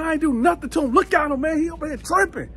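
high-pitched defensive pleading; imitated protest. Inmate defending himself to guards, high excited protest. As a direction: retell yourself protesting your innocence.

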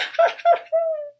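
loud high boo-hoo; hammed-up bawling in bursts; very high crying wail; theatrical wailing sob. Pippi bawling after her speech.

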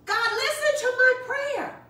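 high, urgent cry to God; pleading shout. Acted. Nehemiah begging God to hear, high cry. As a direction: lift the voice high in plea. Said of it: "I can hear him shouting that".

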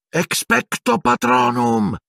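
high, slow, shrieked incantation; Harry casting at the dementor, shrieking the spell. A narrator's reading. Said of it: "strillò Harry".

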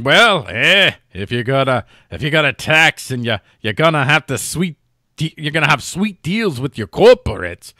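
brisk mock Jersey accent peppered with "eh"; badly faked British man from New Jersey, playing it for laughs. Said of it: "have to throw in a eh".

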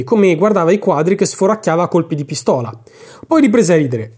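ordinary delivery, nothing marked

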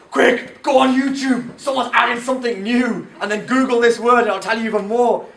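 imitating an eager friend; excited urging. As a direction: enthusiastic retold urging. Friend urging him to look online.

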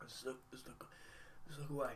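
very soft, barely voiced; muttering to himself. Real, not acted. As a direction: quietly talk yourself out of watching. Telling himself not to watch.

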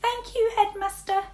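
high voice behind a tuba; muffled voice. A narrator's reading. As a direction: speak from behind the instrument. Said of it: "came a muffled voice".

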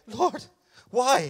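high-pitched plaintive cry to God; pleading protest. Acted. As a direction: wail it as a mock complaint upward. Acted complaint to God.

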